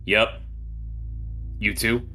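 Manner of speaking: ordinary talk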